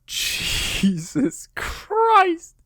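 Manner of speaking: very high and slowly drawn out; shocked exclamation. Real, not acted. Hearing Bam Bam is the last survivor, a long stunned gasp of shock. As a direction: stretch it out in pure disbelief.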